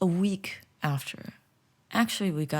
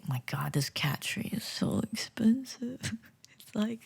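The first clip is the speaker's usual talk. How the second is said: hushed complaint; 4 semitones higher, 5 dB quieter than usual. Real, not acted. Complaining about cat tree cost.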